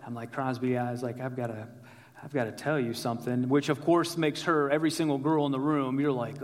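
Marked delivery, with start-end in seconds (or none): 1.2-3.5 low, quiet, halting lead-in to bad news; tentative, softly spoken, stopping and restarting; hesitant confession. Nervous man to his girlfriend before admitting debt, hesitant confession. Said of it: "I'm trying to find the words".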